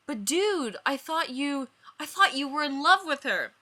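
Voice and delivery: high shocked disbelief; shocked Joey. Acted. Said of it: "eyes wide".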